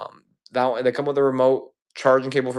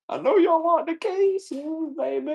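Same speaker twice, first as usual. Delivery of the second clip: very high, slow, crooning falsetto; mock sexy voice; slower than usual. Seller teasing viewers about the cases, hammed-up high sultry croon. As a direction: serenade the buyers in a high croon. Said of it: "Man, that was sexy".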